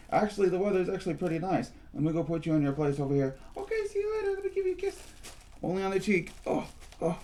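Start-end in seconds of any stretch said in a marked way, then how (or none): 3.6-5.0 very high squeaky puppet voice; high-pitched puppet character; squeaky toy voice. Acted. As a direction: play a tiny squeaky doll. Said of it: "what kind of voice we have".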